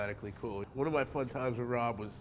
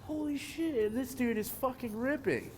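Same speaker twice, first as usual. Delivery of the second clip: much higher, thrilled exclamation; amazed excitement; 13 semitones higher than usual. Retold or imitated. Watching the new rider rip, excited and amazed. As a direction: blurt it like you can't believe your eyes. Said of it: "I was like".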